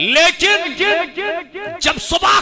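loud, high shouted storytelling; fiery sermon climax; preaching at full force. Acted. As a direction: thunder out the saint's victory over the devil.

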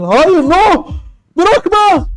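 high, loud, hammed-up protest; mock panicked cry. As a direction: cry out as if begging them to stop. Mock panic as life support ends. (abartılı panikle bağırma)

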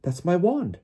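high-pitched exclamation; Harry blurting. A narrator's reading. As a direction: blurt the claim out. Harry blurts out his claim.

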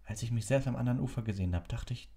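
put-on unsure boy. A narrator's reading. Harry voiced in story.

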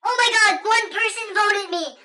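high, loud outburst of alarm; hammed-up panic; freaking out. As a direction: cry out in dramatic disbelief, drawing it out. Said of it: "guys, I'm freaking out".

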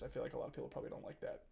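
fast, soft whisper to yourself; whispered aside. Real, not acted. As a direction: mutter it as a quick self-conscious aside. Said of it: "whispered aside".

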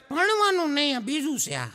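put-on a know-it-all little boy; child voice. Schoolboy explains to teacher. (શિક્ષકને સમજાવતો છોકરો, ઊંચા બાળ અવાજે)

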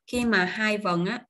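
ordinary talk, nothing marked

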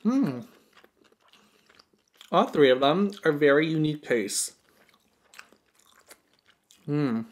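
chewing while talking; muffled with food in mouth; mouth full of food. Real, not acted. Seen 2: Bites food at -2, chews with mouth full through the words.

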